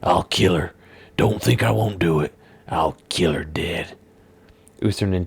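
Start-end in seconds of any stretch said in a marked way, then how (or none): 0.0-4.0 menacing villain. Acted. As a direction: hiss the final promise to kill. Villain threatens to kill Samara.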